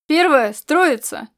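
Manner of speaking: command. A narrator's reading. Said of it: "он скомандовал".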